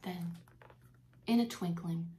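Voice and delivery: put-on lively read-aloud for children; reading the poem to the class, with expression; storybook reading. Teacher reading Christmas poem to kids, animated storytelling.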